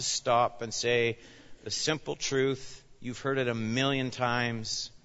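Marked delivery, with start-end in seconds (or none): none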